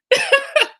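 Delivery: high, short giggling burst; real high-pitched chuckle; laughter. Real, not acted. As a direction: laugh at your own story. Laughing at her own story.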